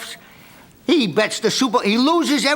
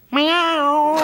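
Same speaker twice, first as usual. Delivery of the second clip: mock cat meow; 7 semitones higher than usual. Dog punchline answer.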